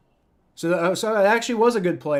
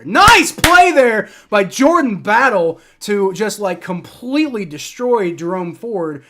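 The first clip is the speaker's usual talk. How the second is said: loud, slow, cheering play-call; excited praise; 5 semitones higher, 10 dB louder, slower than usual. Real, not acted. Fan cheering his defense's big tackle, excited and loud. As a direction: hype up the hit.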